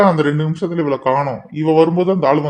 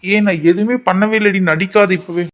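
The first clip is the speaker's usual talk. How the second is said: raised, higher-pitched exasperation; agitated protest; 4 semitones higher, 4 dB louder than usual. Acted. Boy insisting she is only acting, agitated. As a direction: snap back in disbelief.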